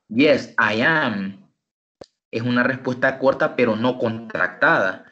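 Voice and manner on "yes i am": slow, careful "Yes, I am"; said slowly and clearly. Shown as a demonstration. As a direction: spell out "Yes, I am" word by word. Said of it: "yo digo de esta manera". ("Yes, I am" dicho despacio y claro)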